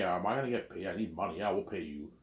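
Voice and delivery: ordinary delivery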